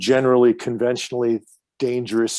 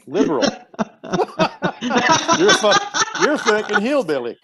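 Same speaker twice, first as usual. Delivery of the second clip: laughing; 14 semitones higher than usual. Real, not acted. Laughs while teasing the guest.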